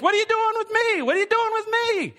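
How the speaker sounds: high, pleading what are you doing with me; exasperated cry to God. Retold or imitated. Pastor retells crying out to God about the neighbor, high and exasperated. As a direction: throw the question up at God, exasperated.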